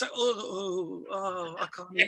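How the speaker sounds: feeble groaning oh-oh; feigning infirmity. Retold or imitated. Passenger conning the flight attendant, mock feeble groans. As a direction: groan as if badly faking a poorly passenger. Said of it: "starts to act slightly more dis".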